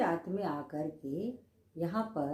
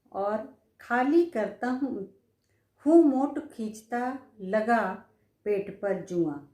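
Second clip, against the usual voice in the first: slow, louder declaimed verse; poem recitation; 3 semitones higher, 4 dB louder, slower than usual. Acted. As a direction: drag the words out like a recital. (धीमा, ज़ोरदार कविता पाठ)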